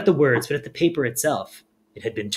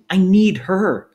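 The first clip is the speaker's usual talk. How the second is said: slow, louder, higher cracking plea; desperate plea; 6 semitones higher, 7 dB louder, slower than usual. A narrator's reading. Luna insisting she needs her grandmother, slow cracking plea. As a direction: plead as if about to break.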